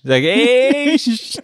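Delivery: very high "Sag ich" squeaked; squeaky German. Shown as a demonstration. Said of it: "could you give us a bit of".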